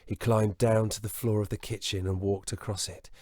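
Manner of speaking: ordinary delivery, nothing marked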